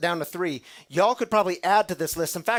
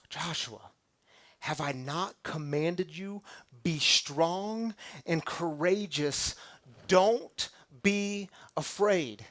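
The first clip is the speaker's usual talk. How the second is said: God commands Joshua, slow and tender; solemn scripture reading; slower than usual. Acted. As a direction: let each phrase land slowly. Reading God's verse slowly.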